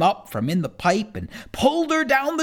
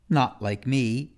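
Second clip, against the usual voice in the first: ordinary delivery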